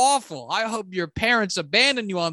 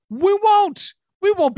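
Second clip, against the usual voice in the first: higher, loud flat refusal; emphatic denial; 9 semitones higher, 5 dB louder than usual. Real, not acted. Jets fan rejecting the hopeful idea, loud and higher. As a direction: slam the door on hope.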